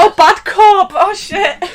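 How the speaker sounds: high, loud excited shout; excited outburst. Real, not acted. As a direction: burst out in delight. Realising the misread word.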